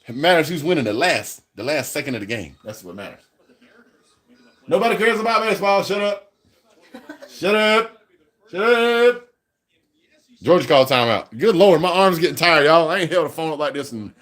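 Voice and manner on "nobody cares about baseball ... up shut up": high, silly telling-off; high-pitched dismissal. Real, not acted. Fan shutting down baseball talk in the chat, high-pitched dismissal. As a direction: pitch it high and shoo the topic away.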